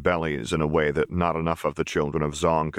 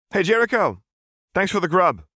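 put-on much higher, louder, chirpy boy thanks; cheerful kid; 14 semitones higher, 5 dB louder than usual. A narrator's reading. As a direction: call out happily like a kid. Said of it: "Milo said".

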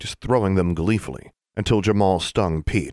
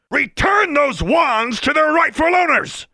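slow, much higher, louder shouted command; shouting Mister Brown; 6 dB louder, slower than usual. A narrator's reading. Furious official orders the girl to return wands. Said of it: "Mister Brown shouted".

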